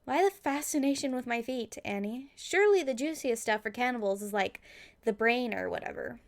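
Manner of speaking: normal talking voice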